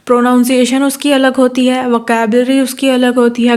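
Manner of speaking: normal talking voice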